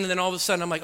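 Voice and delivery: ordinary delivery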